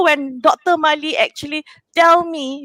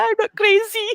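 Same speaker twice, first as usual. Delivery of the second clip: tearful; 7 semitones higher than usual. Retold or imitated. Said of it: "I was like".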